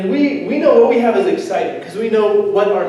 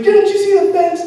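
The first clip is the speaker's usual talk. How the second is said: high, loud mock angry homeowner; upset lady; 12 semitones higher, 3 dB louder than usual. Telling off a door-to-door student, high and shrill-voiced. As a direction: squeal it like the cross lady at the door.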